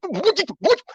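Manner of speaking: high disbelieving squeak; shocked what. Real, not acted. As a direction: react in sheer disbelief. Shock at forgiveness comment.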